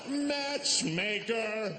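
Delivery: hammed-up announcement; mock matchmaker cry. Comic crowning himself matchmaker, slow proud high call.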